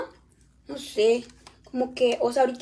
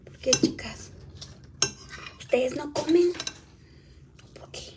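whisper; 6 dB quieter than usual. Real, not acted. Whispered aside to viewers.

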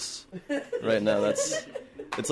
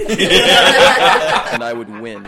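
laughing; 13 dB louder, much slower than usual. Real, not acted. Boasting racehorse joke, laughing.

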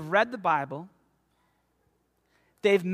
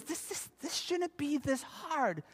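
mild father-in-law; 7 semitones higher, 4 dB quieter than usual. Retold or imitated. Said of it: "he's even saying".

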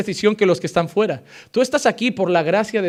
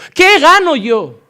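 ¿Qué gano yo? exclamado fuerte, lento y más agudo; fuerte y lenta; 8 semitones higher, 8 dB louder, much slower than usual. Acted. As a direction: lanza ¿Qué gano yo? con fuerza, palabra por palabra. Pregunta retórica del pastor. (¿Qué gano yo? gritado despacio)

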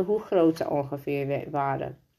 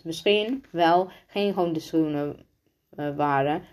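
ordinary delivery, nothing marked

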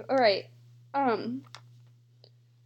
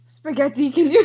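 mock Italian accent breaking into laughter; 6 dB louder than usual. Player giving a spaghetti clue, laughing Italian accent. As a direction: attempt Italian, then crack up.

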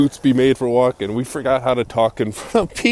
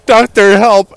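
loud, higher plea for the doctor; panicked cry for help; 5 semitones higher, 8 dB louder, slower than usual. Pretend. Acting out a resuscitation.

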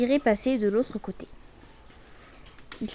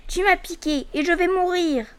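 frog cries out; 7 semitones higher than usual. Acted. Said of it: "cria-t-elle".

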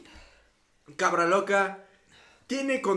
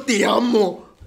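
emotional sob; 2 semitones higher, 10 dB louder, much slower than usual. Real, not acted. Overcome after the win.

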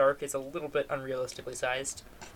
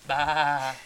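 sheep bleat; 5 dB louder than usual. Retold or imitated. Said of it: "the sheep says".

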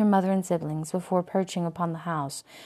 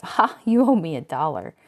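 triumphant; 8 dB louder than usual. A narrator's reading. Rowena triumphs over narrator.

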